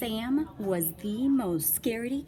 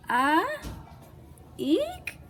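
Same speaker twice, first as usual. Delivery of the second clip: puzzled little eek; 10 semitones higher than usual. Acted. Kids puzzled, monsters gone.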